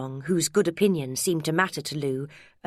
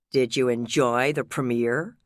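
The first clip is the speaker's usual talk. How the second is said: lower, unhurried American voice; gravelly American accent; 5 semitones lower than usual. A narrator's reading. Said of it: "in a gravelly American accent".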